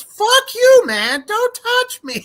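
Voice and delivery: a tickled toy swearing back; shrieking toy voice; Elmo voice. Retold or imitated. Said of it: "and he goes".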